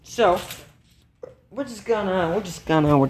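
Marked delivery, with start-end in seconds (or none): none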